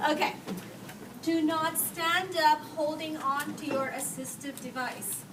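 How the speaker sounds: ordinary talk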